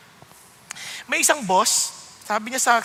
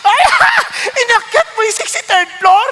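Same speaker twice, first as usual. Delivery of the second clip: laughing disbelief; 9 semitones higher, 6 dB louder than usual. Retold or imitated.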